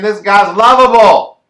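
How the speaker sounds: loud, high burst of delight; gushing outburst. Real, not acted. Host gushing about a fighter, loud excited outburst.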